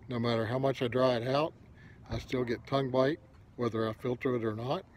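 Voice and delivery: usual speaking voice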